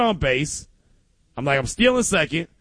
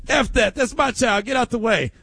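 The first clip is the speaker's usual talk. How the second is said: higher, punchy fierce mom; fierce mom quote; 7 semitones higher than usual. Retold or imitated. Listener's mom shoving people aside for her child, fierce. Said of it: "hearing Central Coast Raider talk about".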